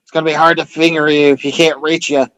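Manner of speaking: ordinary talk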